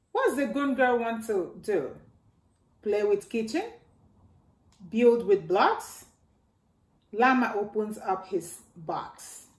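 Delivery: ordinary delivery, nothing marked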